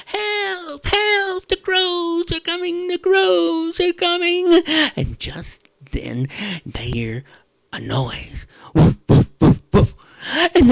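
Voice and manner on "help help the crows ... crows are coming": high squeaky cries for help; panicking snake. Acted. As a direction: shriek like a scared snake.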